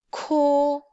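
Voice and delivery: airy k then held vowel in "ko"; aspirated k. Shown as a demonstration. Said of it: "let's just read each sound".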